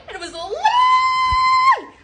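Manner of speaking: very high, long squealed "lie"; drawn-out shriek. Real, not acted. Storyteller wailing about being deceived, drawn-out shriek. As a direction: shriek it out, stretching the last word.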